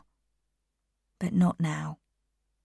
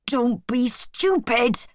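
higher, slow, exasperated cry; angry shout; 5 semitones higher, slower than usual. A narrator's reading. Benjamin, very angry, scolds the animals, exasperated cry. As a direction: scold them, stretching each word. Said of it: "he shouted".

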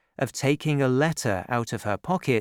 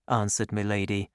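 ordinary delivery

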